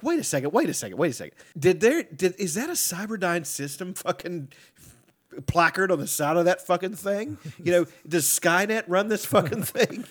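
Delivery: hammed-up disbelief, voice climbing; incredulous. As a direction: retell it as an indignant double take. Acts out alarmed reaction to robot.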